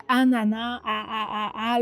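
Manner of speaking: "anana" slowly, then "a" repeated on its own; isolating first sound. Shown as a demonstration. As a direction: draw out "anana" and tap out its first "a". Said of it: "le premier son entendu".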